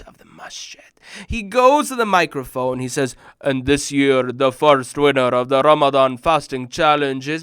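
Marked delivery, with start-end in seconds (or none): 3.4-7.4 louder booming imam announcement, held on is; imitating the imam. Imam at the masjid microphone announcing the winner, booming. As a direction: sound like the imam at the mic. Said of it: "because that's how my imam sounded".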